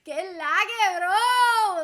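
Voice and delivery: loud holler rising high; hammed-up shout, climbing in pitch; hyped greeting. Shown as a demonstration. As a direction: call it out big to a buddy. Hamming up Puerto Rican greeting.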